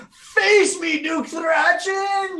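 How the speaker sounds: high, drawn-out battle cry; warrior's challenge. Acted. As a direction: call out the Duke, stretching the name. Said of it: "he goes".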